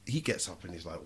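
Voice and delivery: ordinary talk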